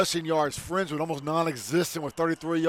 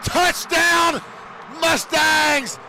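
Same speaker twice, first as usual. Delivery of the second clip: much higher, louder shout, slowing at the name; touchdown shout; 8 semitones higher, 3 dB louder, much slower than usual. Real, not acted. Announcer celebrating the home touchdown, excited shout. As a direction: belt out the touchdown and the scorer.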